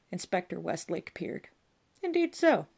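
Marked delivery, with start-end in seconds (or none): 2.0-2.7 much higher mock-surprised; high exclamation. A narrator's reading. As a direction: lift it high. Inspector peering at body.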